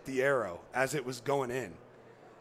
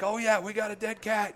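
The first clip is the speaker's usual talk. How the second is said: higher, excited imitation; mock excited guide, higher; fired-up shout; 8 semitones higher, 3 dB louder than usual. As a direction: retell the guide's joy.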